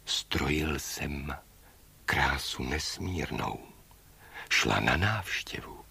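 slow, low, very soft voice; hushed bird figure. A narrator's reading. Bird Mogul explains his lateness to Baba Yaga, hushed. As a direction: answer slowly, almost under the breath.

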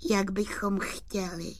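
high, gentle doll voice; high-pitched, delicate and kind; silvery little voice. Acted. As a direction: answer in a small silvery voice. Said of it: "stříbrným hláskem".

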